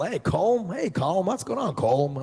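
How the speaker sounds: Colm as one syllable "kohlm", high, chanted greetings; mocking wrong Colm. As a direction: echo the crew hailing "kohlm" over and over. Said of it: "They're all pronouncing Colm wrong".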